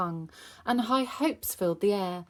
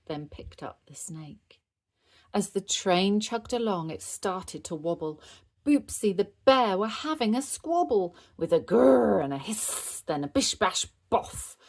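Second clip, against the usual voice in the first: ordinary delivery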